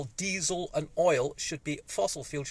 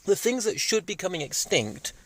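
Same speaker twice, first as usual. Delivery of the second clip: ordinary talk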